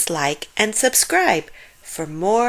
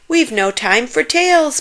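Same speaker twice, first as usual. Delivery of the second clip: puffing; 7 semitones higher, 4 dB louder than usual. A narrator's reading. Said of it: "puffed Grandfather".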